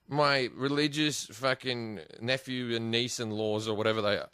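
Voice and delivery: ordinary talk, nothing marked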